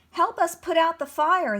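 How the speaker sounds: high, loud pleading; begging. A narrator's reading. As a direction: beg them for help urgently. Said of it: "the animals begged".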